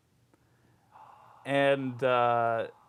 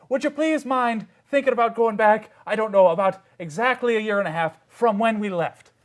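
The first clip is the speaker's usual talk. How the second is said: raised, insistent character voice; Sir Archibald; 5 semitones higher, 4 dB louder than usual. Acted. GM as a knight requesting time travel, insistent. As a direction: plead with forced politeness, pitched up. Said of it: "he goes".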